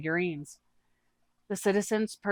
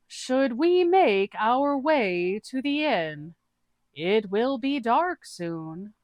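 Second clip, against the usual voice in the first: high, slow, drawn-out goofy voice; a dim, cheerful adventurer explaining cats; [name]'s character voice; 6 semitones higher, 4 dB louder, much slower than usual. Acted. [name] tells the group about cat people, high and slow.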